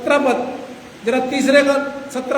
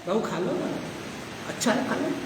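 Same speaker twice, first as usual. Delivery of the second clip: coaxing lovingly; 3 semitones lower, 12 dB quieter than usual. Retold or imitated. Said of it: "बीवी ने प्यार से दिया".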